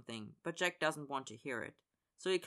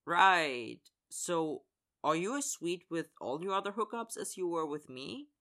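louder scoffing right; 3 semitones higher, 4 dB louder than usual. A narrator's reading. Jack scoffing at Connor's excuses, sarcastic. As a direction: scoff and challenge him. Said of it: "Jack scoffs".

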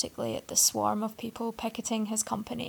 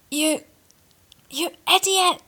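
furious roar, much higher and louder; 9 semitones higher, 9 dB louder than usual. A narrator's reading. Arthur roars at the boy who spilled coffee. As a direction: roar it at the clumsy stranger. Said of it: "he roared".

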